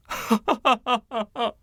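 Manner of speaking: high, unrestrained laugh; wild laugh. Acted. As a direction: throw back your head and laugh wildly. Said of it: "He laughed wildly".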